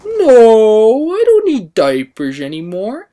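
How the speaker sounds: put-on high, loud childish protest; little kid protesting. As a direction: shout it like an offended five-year-old. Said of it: "the way I reacted was".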